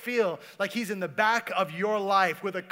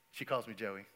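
ordinary talk

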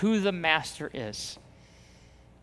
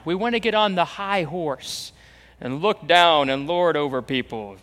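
normal talking voice

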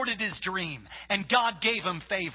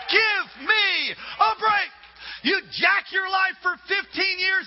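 desperate prayer. Retold or imitated. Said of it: "And I'm saying".

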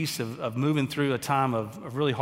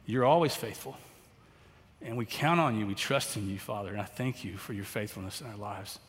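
prayer; 2 semitones lower than usual. Acted. Pastor leading the congregation in closing prayer, reverent.

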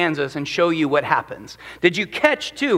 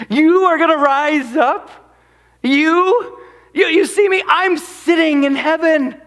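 amused mockery; 7 semitones higher, 4 dB louder than usual. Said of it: "He goes".

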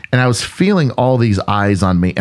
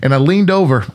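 ordinary talk, nothing marked

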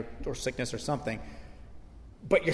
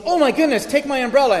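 high, loud gushing concern; concerned girl; 11 semitones higher, 8 dB louder than usual. Retold or imitated. Young girl offering her umbrella to a soaked stranger, high and gushing. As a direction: squeal it like an alarmed young girl. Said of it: "And she goes".

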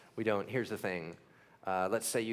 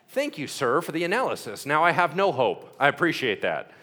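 sarcastic thanks; 5 semitones higher, 10 dB louder than usual. Retold or imitated.

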